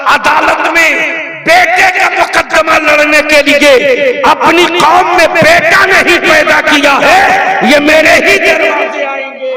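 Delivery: high, shouted rally tirade; fiery oratory. Real, not acted. Orator berates his community over court cases, fiery oratory. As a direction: thunder it out to the whole crowd. Rouses the crowd.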